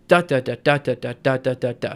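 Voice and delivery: DA-da-da, evenly tapped out; three-beat rhythm. Shown as a demonstration. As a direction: chant "da" in threes, first of each stressed. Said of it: "it will go".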